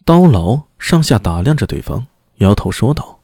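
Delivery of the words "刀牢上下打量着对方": plain reading voice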